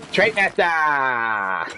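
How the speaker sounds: slow, stretched-out teasing holler; cousin's boastful call. Retold or imitated. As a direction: imitate a show-off crowing into the chat. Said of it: "in the chat and go".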